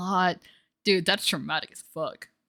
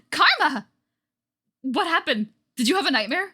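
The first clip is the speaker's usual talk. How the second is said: calling out; 8 semitones higher, 5 dB louder than usual. Acted. Calling out to him.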